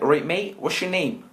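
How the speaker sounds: high, mock Bristol accent. School kids greeting the new boy, mock Bristol accent. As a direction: imitate a farmer-like Bristol accent. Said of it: "they had a Bristol accent".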